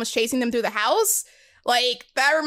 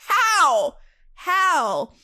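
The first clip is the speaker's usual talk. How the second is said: baffled; 6 semitones higher, 4 dB louder than usual. Retold or imitated. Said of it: "And you're like".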